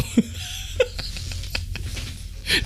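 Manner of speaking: high, wheezy giggling fit; helpless laughter. Real, not acted. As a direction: dissolve into laughter. Cracks up again.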